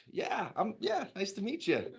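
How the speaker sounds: high, bright, friendly mock-cheer imitating Michael; cheery greeting. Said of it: "He was just cheery as fuck".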